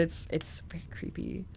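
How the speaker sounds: hushed, creeped-out whisper; spooked whisper about the creepy figure. Real, not acted. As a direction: breathe it out, unnerved.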